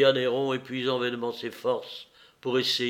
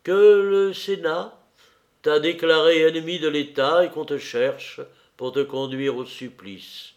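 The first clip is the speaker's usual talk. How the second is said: cruel announcement; 5 semitones higher, 6 dB louder than usual. A narrator's reading. Sporus announces Nero's condemnation.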